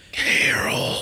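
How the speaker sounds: breathy, raspy whispered name; hoarse whisper. Acted. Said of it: "He says the name in a hoarse whisper".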